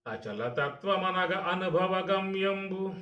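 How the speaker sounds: slow, high, drawn-out chanting of అచలతత్వమనగ అనుభవ గమ్యంబు; poem recitation. Shown as a demonstration. Teacher reciting Vemana's verse to listeners, in slow chant.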